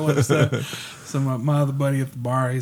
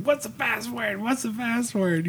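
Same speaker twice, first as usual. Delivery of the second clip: frantic; 9 semitones higher, 4 dB quieter than usual. Retold or imitated. Brother messaging friends for the bar password, frantic. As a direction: mimic someone urgently begging for an answer. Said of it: "he's real frantic".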